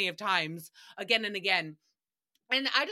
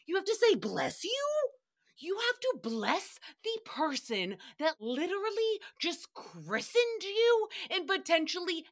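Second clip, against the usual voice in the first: higher-pitched, disbelieving rant; incredulous; 5 semitones higher than usual. Real, not acted. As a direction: question it as if it's utterly absurd. Ranting in disbelief at the custom.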